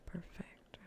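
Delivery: ordinary talk, nothing marked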